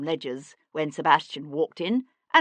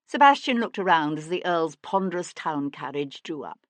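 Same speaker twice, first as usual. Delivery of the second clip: ordinary delivery, nothing marked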